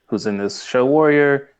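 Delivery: ordinary delivery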